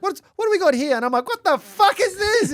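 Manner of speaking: high, loud retold alarm; alarmed disbelief. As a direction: demand to know what this is.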